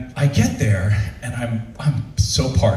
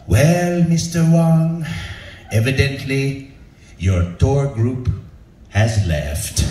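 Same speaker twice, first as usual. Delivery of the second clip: imitating a slow director, pausing between phrases; measured, drawn-out bad news; very slow, calm; much slower than usual. Director tells him the tour left, very slowly. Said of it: "this director was speaking was so slow".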